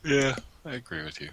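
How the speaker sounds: talking through a yawn. Real, not acted. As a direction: yawn as you say it. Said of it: "you're yawning here".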